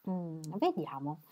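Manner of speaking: slow, soft, sly musing; mischievous. A narrator's reading. Mary teasing Fred while pretending to think, mischievous. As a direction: toy with him, lips twisted. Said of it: "storcendo maliziosamente gli angoli della bocca".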